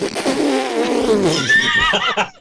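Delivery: high, loud burst of helpless laughter; re-enacted uncontrollable giggling fit; insane laughing fit. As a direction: crack up halfway through the script. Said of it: "I started laughing insanely".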